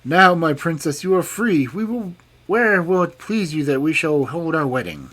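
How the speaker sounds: loud, high, triumphant king; triumphant cry. A narrator's reading. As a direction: crow it out in victory. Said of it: "he cried triumphantly".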